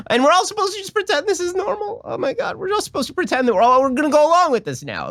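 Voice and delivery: high, squeaky disbelief; high-pitched incredulous rant. Real, not acted. Mock disbelief at pronoun video.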